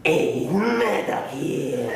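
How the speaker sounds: very slow, drawn-out put-on Murdoch growl; a slow, hammed-up Murdoch impression. Shown as a demonstration. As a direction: stretch every word out like a sleazy rock star. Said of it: "I'm gonna talk like this".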